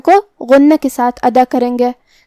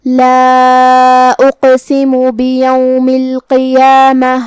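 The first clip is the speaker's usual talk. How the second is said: slow, chanted tajweed recitation; Quran recitation; much slower than usual. Acted. Teacher reciting verses for the students. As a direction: recite the verses slowly and melodically.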